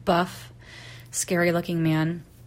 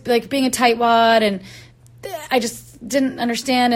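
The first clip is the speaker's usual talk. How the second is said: plain reading voice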